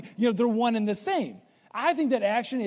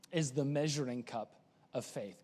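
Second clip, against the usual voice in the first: quiet; 8 semitones lower, 8 dB quieter than usual. Shown as a demonstration. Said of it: "you know this".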